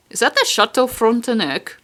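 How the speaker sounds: Québécois French flavour on the name; Canadian accent. Pretend. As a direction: say it like a Quebec local. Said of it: "I said that in a really Canadian voice".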